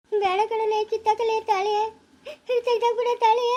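high tearful pleading; wailing plea; crying. Acted. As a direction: beg through sobs. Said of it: "ಅಳ್ಬೇಡ".

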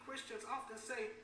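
ordinary delivery

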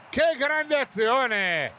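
slow, high, stretched exclamation; excited. Real, not acted. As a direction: savour the great move. Repeated exclamation after goal. (esclamazione entusiasta)

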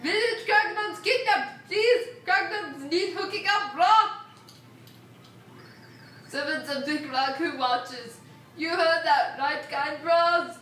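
lightly voiced grumpy old man; a hint of a pleading old man. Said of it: "your old man voice".

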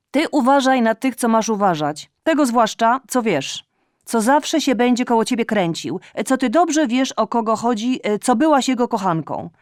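high, loud reproachful tirade; scolding mother. Acted. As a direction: nag her like an aggrieved mother. Mother warning loudly. (matka z pretensją podniesionym głosem)

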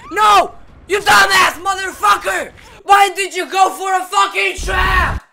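very high, loud enraged shouts; furious screaming. Real, not acted. Gamer furious at a teammate stepping into a trap, screaming. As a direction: scream at the dumb teammate, full fury. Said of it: "for screaming and yelling".

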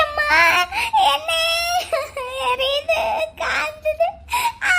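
high-pitched drawn-out mock cries; fake wailing in pain. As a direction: wail each word as if burning. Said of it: "எங்க தங்கச்சி இங்க அழுதுகிட்டு இருக்கா".

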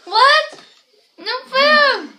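loud high shout from another room. Real, not acted. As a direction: call out sharply, high and loud. Someone shouting from the back.

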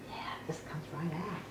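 soft whispered aside. Real, not acted. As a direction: whisper it off camera. Aside while filming.

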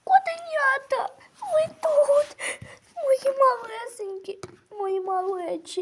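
high, weepy relief at finding them; a mother near tears, relieved; tearful relief. Acted. Mother finds lost kittens.

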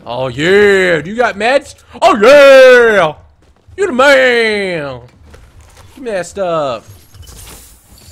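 loud, high, thrilled cheering; excited. Real, not acted. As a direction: cheer like you won. Excited about meds.

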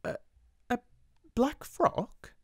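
high, halting startled stammer. A narrator's reading. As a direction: trip over the words. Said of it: "stammered again".

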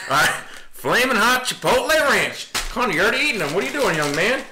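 high, hollered cowboy twang; cowboy accent. Acted. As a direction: call it out like a hammed-up cowboy. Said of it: "we'll just do the old cowboy one".

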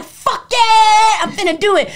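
loud high fired-up yes; answering mom, pumped and loud; defiant excitement. Retold or imitated. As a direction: hype yourself up. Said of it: "I'm like".

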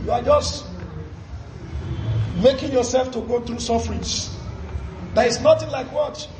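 usual speaking voice

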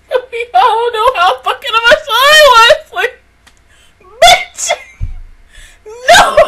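high, loud anguished yell; emotional outburst. Real, not acted. To viewers about the show's losses, loud and high. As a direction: blurt it out in real pain. Reliving anime character deaths.

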